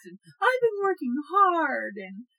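mock-pitiful begging; pleading excuses. S1 imitating her excuses to Jeff, mock pleading. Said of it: "making up so many excuses".

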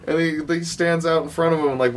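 ordinary talk

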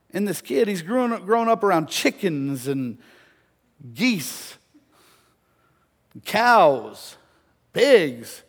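emphatic storytelling with a pause between animals; punched list. Real, not acted. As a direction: belt out each animal on its own. Preacher punches list of familiar animals.

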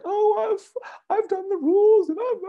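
very high whiny mocking falsetto; mock victim voice. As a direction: parody a self-pitying victim. Mocking people who play victims.

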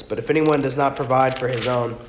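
usual speaking voice

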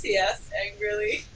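ordinary delivery, nothing marked

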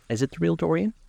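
high, rising question; eager cry. A narrator's reading. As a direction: cry it out as a question, pitch lifted well up. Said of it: "cried the original of the portrait".

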